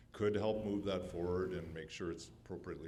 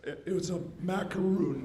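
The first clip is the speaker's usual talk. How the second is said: high, loud strained voice; choking; 13 semitones higher, 12 dB louder than usual. Real, not acted. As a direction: force words out after choking. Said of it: "sorry, I'm choking".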